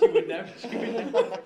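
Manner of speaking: words squeezed out through laughter; cracking up mid-sentence; laughing. Real, not acted. Friend laughing while telling the teacher story.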